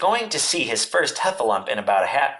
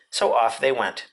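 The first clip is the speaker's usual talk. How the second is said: ordinary delivery, nothing marked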